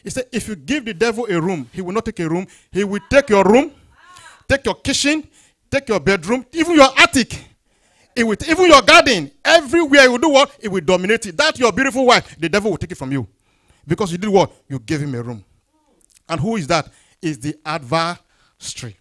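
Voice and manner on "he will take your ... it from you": building to high, loud preaching; forceful preaching. Acted. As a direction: pile up the list with mounting force.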